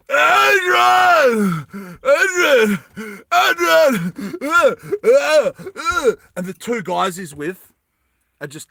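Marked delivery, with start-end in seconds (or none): 0.1-6.1 high, anguished hammed-up yell; Sylvester Stallone. Climber yelling for Adrian while held back, overacted shout. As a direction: scream the name, then strain and grunt. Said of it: "Sylvester Stallone's yelling out".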